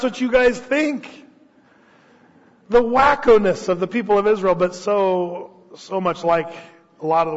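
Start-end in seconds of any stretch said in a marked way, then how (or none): none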